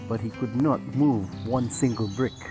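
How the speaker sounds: ordinary delivery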